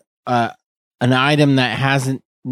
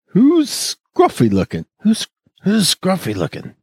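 Han Solo; 7 semitones higher than usual. Retold or imitated. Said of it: "a Star Wars Han Solo reference".

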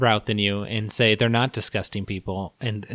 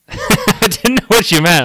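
laughing; 9 semitones higher, 5 dB louder than usual. Real, not acted.

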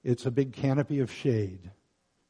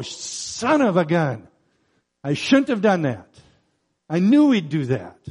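higher, louder exasperated kicking oneself; exasperated outburst; 4 semitones higher, 6 dB louder than usual. Acted. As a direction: exclaim it in annoyed disbelief, drawing out the first phrase. Acts exasperated reaction to God's mercy.

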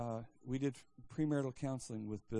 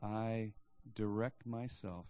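solemn declaration; 4 semitones lower, 4 dB quieter, slower than usual. Shown as a demonstration. Solemn declaration lower, softer.

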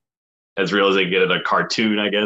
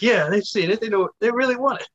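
high-pitched mock believer; put-on voice; 12 semitones higher than usual. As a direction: imitate someone eagerly convinced, pitched way up. Voicing the cameo givers in mock belief.

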